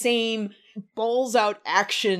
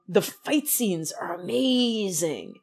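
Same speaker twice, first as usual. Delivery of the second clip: ordinary talk, nothing marked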